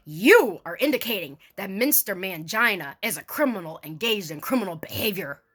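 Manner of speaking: ordinary talk, nothing marked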